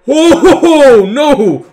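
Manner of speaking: loud, high cry of dread; startled cry. Real, not acted. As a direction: wail in sudden alarm. Scare in the game.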